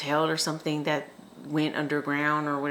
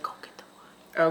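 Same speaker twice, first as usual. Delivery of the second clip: whisper. Retold or imitated. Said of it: "He said".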